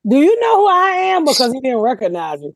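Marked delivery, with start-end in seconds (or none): 0.1-1.2 high, fast, indignant celebrity line; mock diva demand. To the popcorn guard at the theatre, mock diva demand. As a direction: toss it off like a hammed-up star. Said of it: "I didn't wanna say".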